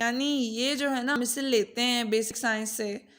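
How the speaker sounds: ordinary talk, nothing marked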